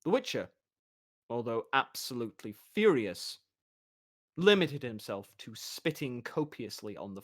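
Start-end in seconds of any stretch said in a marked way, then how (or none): none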